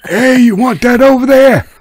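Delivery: high, loud dockside holler; gruff dock worker hollering. Retold or imitated. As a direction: yell it across the docks. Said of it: "you sound like a dock worker I knew named Nick".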